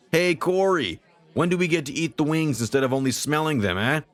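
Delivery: high-pitched pest of a party guest; loud, annoying guest. A narrator's reading. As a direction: pitch it way up, pestering the cook for food. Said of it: "gotten more loud and ever more annoying".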